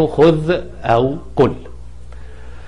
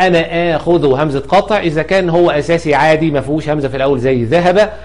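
normal talking voice

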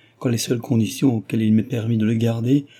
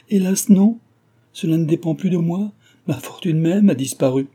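raised, louder cry of regret; distressed confession; 8 semitones higher, 5 dB louder than usual. A narrator's reading. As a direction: lament it openly, pitched well up. Heroine confesses her lost fortune.

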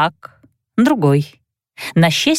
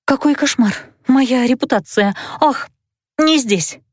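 frightened mumble; 5 semitones higher than usual. Acted. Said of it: "я забормотала".

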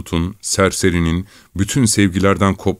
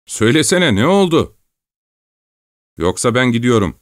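higher, teasing thief's jeer; mocking taunt; 6 semitones higher than usual. A narrator's reading. Thief mocks the sobbing young peasant, higher teasing jeer. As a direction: needle the crying boy with scorn.

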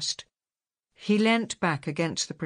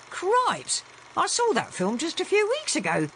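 much higher, surprised exclamation; startled sailor; 12 semitones higher than usual. Acted. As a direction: blurt it out in amazement. Ben startled by the film.

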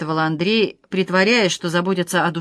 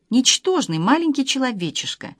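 ordinary delivery, nothing marked

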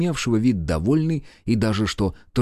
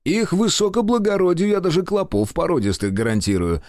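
higher, sneering sarcasm; sarcastic jab; 10 semitones higher than usual. A narrator's reading. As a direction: needle him with a mocking promise. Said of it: "съязвил Пётр Кириллович".

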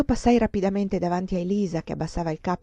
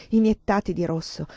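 plain reading voice

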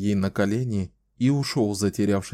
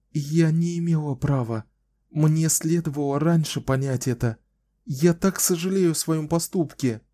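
put-on higher, bright young woman's voice; Kahlan, smiling young woman; 7 semitones higher than usual. A narrator's reading. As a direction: play her hopeful and teasing.

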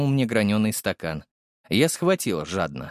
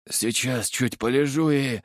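hoarse. A narrator's reading. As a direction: trail off from a parched throat. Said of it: "хриплым". (хрипло, обрываясь)

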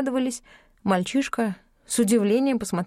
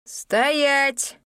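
high, loud command; thundering command; 7 semitones higher, 3 dB louder than usual. A narrator's reading. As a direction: stop the crowd cold. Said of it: "Громовой голос заставил всех присутствующих вздрогнуть".

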